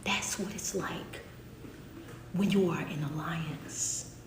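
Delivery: slow, hushed whisper. Real, not acted. Preacher to congregation about God, slow reverent whisper. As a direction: breathe it out slowly, barely voiced. Closing hush after the sermon.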